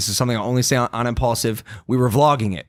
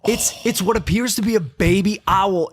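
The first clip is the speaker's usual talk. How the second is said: ordinary delivery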